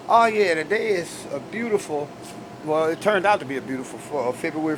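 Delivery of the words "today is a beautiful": ordinary delivery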